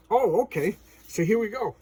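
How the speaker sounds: high, loud mock eagerness; imitated keen compliance; eager agreement. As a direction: act out suddenly catching on. Thanos catches on and agrees.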